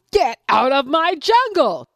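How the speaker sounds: lion ordering rival out; furious lion. Acted. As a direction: bellow at the rival. Lion shouts.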